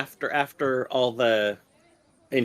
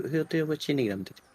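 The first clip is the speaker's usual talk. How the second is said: ordinary talk, nothing marked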